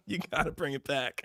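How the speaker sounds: soft laughing repeat; laughing pleading. Real, not acted. Laughing as he begs the creator to return, soft laughing plea.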